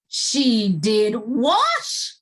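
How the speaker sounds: slow, loud, stretched-out disbelief; shocked cry. Acted. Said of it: "cried the king".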